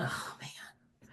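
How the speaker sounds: hushed, breathy-free whisper of regret; regretful whisper. Acted. The girl who didn't register, whispering her regret.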